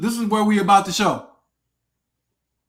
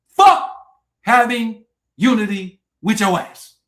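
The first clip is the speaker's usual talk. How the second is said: angry shout; 7 dB louder, slower than usual. Real, not acted. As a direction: yell it at them in fury. Angry rant at viewers.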